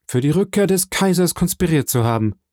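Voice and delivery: a prisoner's reply, lightly acted; lightly voiced prisoner answering. A narrator's reading. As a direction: state the charge as a straight answer. Prisoner states his charge to questioner.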